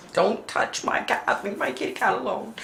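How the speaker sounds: soft breathy plea to leave her cat alone; mock protective whisper. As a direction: whisper it like guarding a pet.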